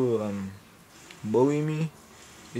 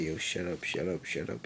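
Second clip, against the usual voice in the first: low, soft repeated plea; urgent hushing; 6 semitones lower, 7 dB quieter than usual. Retold or imitated. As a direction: hush him under your breath, embarrassed. Embarrassed, hushing his intruding dad.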